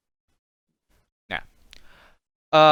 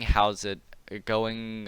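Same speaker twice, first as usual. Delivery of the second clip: ordinary delivery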